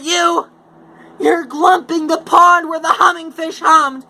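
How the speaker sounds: high, loud, scolding creature voice; indignant Lorax. Acted. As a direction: scold him like a furious little creature. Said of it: "his dander was up".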